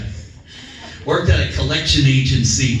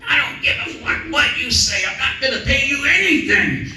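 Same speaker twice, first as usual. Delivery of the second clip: cursing debtor; 9 semitones higher than usual. Retold or imitated. Debtor yelling at the collector, furious high shout. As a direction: yell it like a fed-up debtor.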